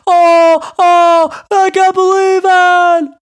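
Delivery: high, screamed broadcaster impression; Dickie V. Host imitates a commentator after buzzer beaters against Kentucky, screaming. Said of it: "Dickie V was screaming".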